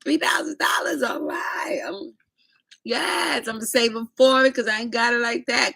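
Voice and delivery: high, fake-agreeable brush-off with a mock whine; brushing someone off. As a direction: pretend to agree to get rid of him. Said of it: "Be like".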